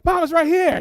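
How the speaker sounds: high, loud retold shout; urgent calling out. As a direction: call Mom over urgently. Child calls out having found it.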